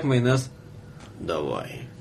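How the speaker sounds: ordinary delivery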